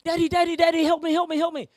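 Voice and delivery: high, loud, frantic cries; screaming petrified child. Retold or imitated. Little boy screaming to his father, terrified. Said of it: "He's screaming".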